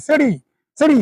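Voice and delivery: সিটি said as city with a short open first vowel; correct pronunciation. Shown as a demonstration. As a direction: model সিটি as city, clearly. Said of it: "মনে রাখেন, সিটি আমরা উচ্চারণ করি". (সিটি সঠিক উচ্চারণে বলা)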